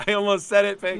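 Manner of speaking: giggling through the words; laughing. Real, not acted. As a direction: laugh while confessing. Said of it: "laughing, teasing cohost".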